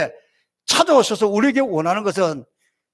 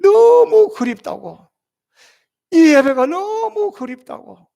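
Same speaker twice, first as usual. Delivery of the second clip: aching longing; 7 semitones higher, 5 dB louder, slower than usual. Retold or imitated. Longing from a deacon's text.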